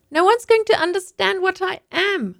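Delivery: put-on high, small child's voice, complaining; little girl's voice. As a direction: speak as a small girl stuck in a costume. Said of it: "I pointed out".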